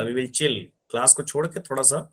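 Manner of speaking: normal talking voice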